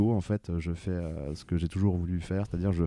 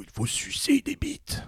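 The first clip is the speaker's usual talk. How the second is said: put-on high, slow old man voice; slower than usual. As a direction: play a doddering old man, drawn out. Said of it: "je fais le, le vieux Bernard Pipoudou".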